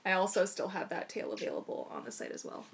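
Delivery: ordinary delivery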